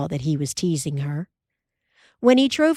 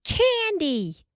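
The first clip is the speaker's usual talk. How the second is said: put-on much higher child's eager cry; girls guessing; 13 semitones higher than usual. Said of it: "answered together".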